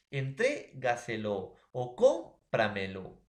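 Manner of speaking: entrégaselo o cómpramelo, slow, with TRÉ and CÓM lifted; stress on TRÉ, CÓM. Shown as a demonstration. Teacher giving sobresdrújula examples, stressing TRÉ and CÓM in entrégaselo o cómpramelo. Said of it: "como palabras sobresdrújulas como".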